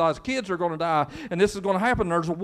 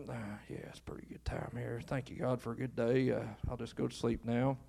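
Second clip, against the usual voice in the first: low, soft, drowsy murmur; sleepy young Samuel; 9 semitones lower, 12 dB quieter than usual. Acted. Samuel thanking God before he goes to sleep, drowsy. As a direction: settle down for the night, voice low. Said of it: "he just lays down and like".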